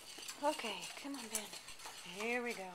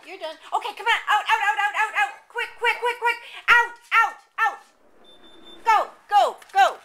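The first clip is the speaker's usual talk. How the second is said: excited high-pitched hurry; 11 semitones higher, 26 dB louder, faster than usual. Real, not acted. Hurrying dogs out, laughing.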